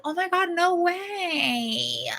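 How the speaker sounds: high, slow fake-sweet customer voice; a put-on high gush, slowed right down; imitating the customer. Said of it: "She literally sounded just like that".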